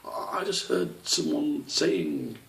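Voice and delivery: put-on high, slow, halting child voice; young squirrel caught spying, stammering; nervous stammer. A narrator's reading. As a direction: stammer it out slowly, scared. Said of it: "Squish stammered".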